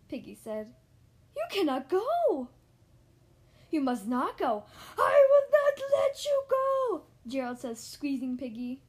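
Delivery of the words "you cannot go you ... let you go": loud, building to a high drawn-out cry; hammed-up pleading, ending in a wail; desperate protest. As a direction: forbid it with rising panic. Gerald desperately forbids Piggy to go.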